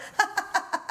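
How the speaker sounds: high mock laugh; imitated laughing. Passenger showing how she laughed every time the phone rang, mock laughter. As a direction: act out helpless, incredulous laughter. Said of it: "I just started laughing".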